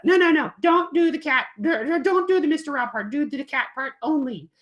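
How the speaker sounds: high, loud pleading kitty; puppet cat voice. Acted. Cat puppet tells kids to do the cat part, high and loud. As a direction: insist like a bossy cat. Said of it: "she tries to coerce them".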